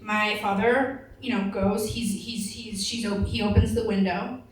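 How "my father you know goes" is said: ordinary talk, nothing marked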